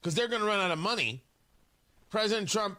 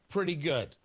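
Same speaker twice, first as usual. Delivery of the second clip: ordinary delivery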